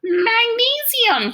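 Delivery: high, loud, slow stretched cry; excited shout. A narrator's reading. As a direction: call the element out in wonder to the sky. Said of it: "he shouts".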